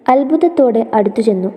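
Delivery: ordinary delivery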